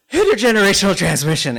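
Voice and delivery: imitating a choked-up friend, overcome with tears; emotional retelling. Friend at graduation, overcome with emotion, tearful loud voice. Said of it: "like, just falling apart".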